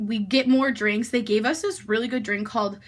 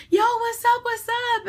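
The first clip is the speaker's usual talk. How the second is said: pitched-up bro voice, lightly done; imitating guys saying hi, much higher; mock bro greeting; 12 semitones higher than usual. Two guys greeting the narrator, hammed-up bro voice.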